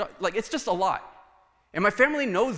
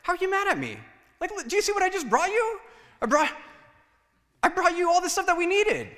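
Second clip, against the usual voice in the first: high, whiny mock protest; pitched-up exasperated self-parody; indignant husband; 13 semitones higher, 4 dB louder than usual. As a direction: play the wounded husband who brought groceries. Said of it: "you get this, like".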